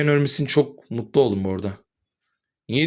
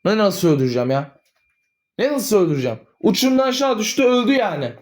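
exasperated outburst; 8 semitones higher than usual. Acted. Son exasperated under questioning.

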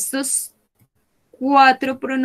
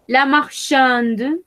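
slow, careful "La marchande"; slow model word; 3 semitones higher, slower than usual. Shown as a demonstration. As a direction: stretch out "La marchande" for the class. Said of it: "teacher models new word". (pronunciación lenta de modelo)

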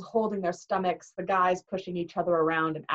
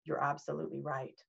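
softer, low and grave; real serious; 4 semitones lower, 10 dB quieter than usual. Retold or imitated. Grover to Percy, quietly dead serious. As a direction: say it dead serious, barely above a breath. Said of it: "looked at me real serious".

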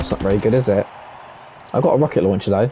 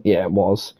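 strained, hoarse throat; 10 semitones lower, 5 dB quieter than usual. Real, not acted. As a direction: talk through a hoarse throat. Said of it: "my voice is fucked now".

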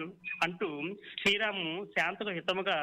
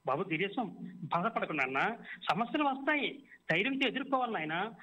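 usual speaking voice

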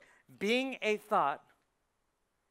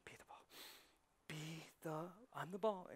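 whispered golf mantra; 3 semitones lower, 10 dB quieter than usual. Retold or imitated. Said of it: "Same thing with golf".

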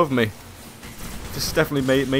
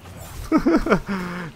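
panicked laugh; 8 semitones higher than usual. Real, not acted. Panicked laughter while swarmed.

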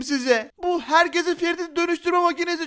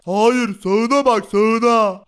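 deep loud voice; 5 semitones lower, 6 dB louder, much slower than usual. Acted. Tarık voiced, deeper and louder.

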